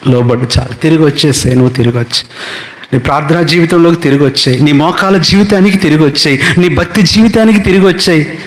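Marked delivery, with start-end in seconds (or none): 2.9-8.4 building sermon climax. Acted. Preacher urging the congregation back to prayer, building to a high climax. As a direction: drive the call to return toward a peak.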